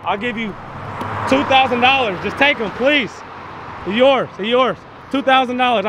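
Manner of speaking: hammed-up pleading offer. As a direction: beg them to take it off your hands. Begging someone to take the dog.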